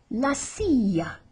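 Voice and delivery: "silla" with the ll said as a y; slow "silla", a y sound for the double L. Shown as a demonstration. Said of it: "the double L is like a Y".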